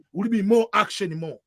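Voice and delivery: normal talking voice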